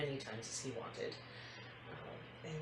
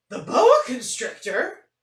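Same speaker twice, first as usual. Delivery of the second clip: shocked mom; 8 semitones higher, 18 dB louder, slower than usual. Acted.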